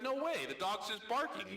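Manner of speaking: high-pitched, dismissive objection; incredulous protest. Acted. Friend disputes the talking dog bet, high incredulous protest.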